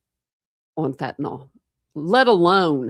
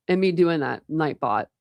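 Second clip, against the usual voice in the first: ordinary delivery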